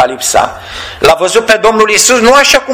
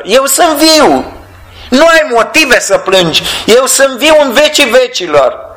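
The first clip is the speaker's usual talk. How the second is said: voicing the Lord; 7 semitones higher than usual. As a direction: proclaim it gently as the risen one. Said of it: "Domnul parcă asta vrea să spună".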